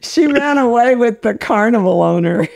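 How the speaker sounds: cracking up while talking; laughing. Real, not acted. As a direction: giggle through the punchline. Laughing at own story.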